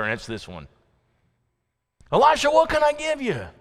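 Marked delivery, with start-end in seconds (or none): 2.1-3.5 high, eager voice of Elijah; Elijah's offer. Acted. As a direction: lift the voice high, offering generously.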